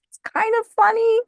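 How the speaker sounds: squeaky high and loud through laughter; can't stop laughing; real laughter breaking the words. Real, not acted. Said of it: "I laugh at inappropriate things".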